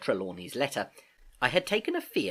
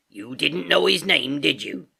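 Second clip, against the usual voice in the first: stern pirate voice; 4 semitones higher, 6 dB louder than usual. A narrator's reading. Silver interrogates.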